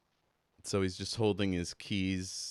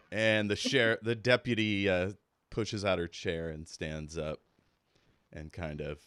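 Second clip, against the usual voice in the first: ordinary talk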